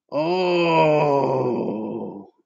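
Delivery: usual speaking voice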